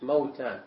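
"موتان" said mawtan, with a silent-vowelled waw; open first vowel. Shown as a demonstration. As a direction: give "موتان" two syllables, maw-tan. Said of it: "فلازم يكون مفتوح".

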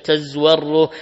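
"تزور" as tazwarru, no vowel on zay; silent zay, doubled ra. Shown as a demonstration. Reader showing Ibn Amir's reading, "تزور" as tazwarru. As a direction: say "تزور" with a doubled r, rhyming with tahmarru. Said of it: "بسكون الزاء وتشديد الراء على وزن تحمر".

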